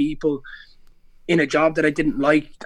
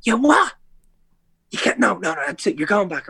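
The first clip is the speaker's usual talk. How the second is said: mock an outraged mom shouting; mom flipping out; 4 semitones higher than usual. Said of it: "my mom flipping".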